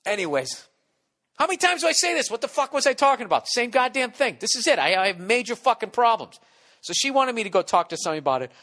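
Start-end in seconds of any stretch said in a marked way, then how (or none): 1.4-6.4 high, loud self-exasperation; loud high-pitched frustrated outburst; exasperated rant. Real, not acted. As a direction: rant at yourself, fed up.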